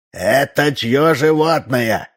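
put-on drawn-out query in an old man's voice; old man asking. A narrator's reading. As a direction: ask slowly as a puzzled old neighbour. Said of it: "спросил старик Ложкин".

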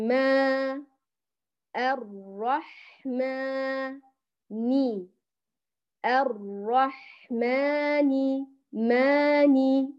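الرحمن stretched very slowly to the end; very slow reading. Shown as a demonstration. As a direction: read الرحمن slowly, holding ماني. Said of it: "نأخذ هنا الملاحظات".

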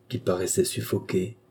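ordinary delivery, nothing marked

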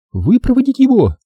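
very high-pitched woman's cry of dismay; desperate woman, pitch shooting up; despairing exclamation. A narrator's reading. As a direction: echo it in high, exasperated disbelief. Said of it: "воскликнула Делла в отчаянии".

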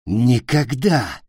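slow, drawn-out growl; growled refusal. A narrator's reading. Питер refuses the captain and attacks, growling. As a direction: growl it out slowly. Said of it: "прорычал Питер".

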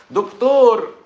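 high and loud shrill call. Retold or imitated. Viewer shouting for the doctor, high and loud. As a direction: yell for the doctor's attention.

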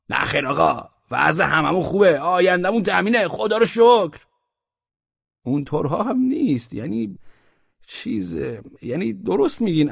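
a merchant who smells a trap; wary merchant. Acted. Man and Osman Bey exchange.

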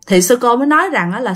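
ordinary delivery, nothing marked

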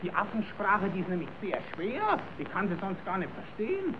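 softly, as if not to scare the animals; hushed. Acted. Kasperl hushing the children to hear the apes, softly. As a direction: drop the voice right down so the apes aren't disturbed. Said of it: "Ihr müsst still sein".

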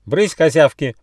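indignant little squirrel's yell; sharp shout. A narrator's reading. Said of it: "прикрикнул на них Рыж".

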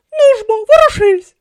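high, very loud yell; bawled command. A narrator's reading. She urges the camel to get moving, bawling loudly. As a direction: bawl an impatient order at full volume. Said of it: "загорлала вона".

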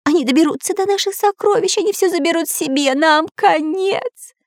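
very high panicking squawk; squeaky cartoon bird in despair; frantic parrot. A narrator's reading. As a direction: fret shrilly like a parrot in alarm. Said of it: "как сумасшедший попугай Кутя-Плютя".

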